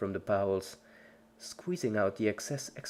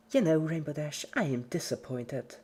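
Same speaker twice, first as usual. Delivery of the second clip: high, sulky disappointment; creepy cheerful Pinkie; 6 semitones higher than usual. A narrator's reading. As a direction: scold her dying friend sweetly. Pinkie to dying Dash.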